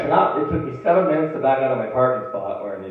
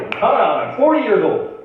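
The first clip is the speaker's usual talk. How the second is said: loud, pitched-up comic declaration; mock proclamation; 9 semitones higher, 6 dB louder than usual. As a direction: proudly declare it, high and loud. Mock announcement of coming out.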